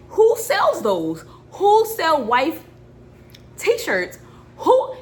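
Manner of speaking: high, loud and slow disbelief; incredulous. Retold or imitated. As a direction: drag out the question in shock. Retelling baffled reaction at work.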